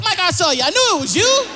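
high gleeful I-caught-you call; high accusing. Retold or imitated. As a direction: catch him out. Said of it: "I said".

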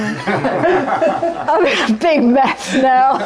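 amused, slowed by laughter; laughing. Real, not acted. Teacher admitting to students she has lost her thread, laughing. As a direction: laugh helplessly at yourself through the words.

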